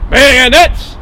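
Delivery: loud, high military order; bellowed command. Acted. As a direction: bellow it like a petty officer. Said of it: "a petty officer bellows".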